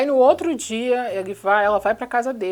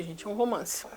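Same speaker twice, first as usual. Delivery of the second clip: muttered, much softer "romance"; dry aside; 2 semitones lower, 11 dB quieter than usual. Real, not acted. Vlogger muttering sarcastically to viewers, quiet aside. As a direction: say "é um romance" under your breath, deadpan.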